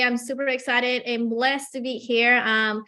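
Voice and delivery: ordinary talk, nothing marked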